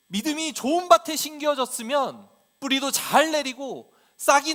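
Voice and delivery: loud, high, full-force preaching reproach; impassioned rebuke as Jesus. Acted. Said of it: "책망하고". (목청을 높여 호되게 책망하듯)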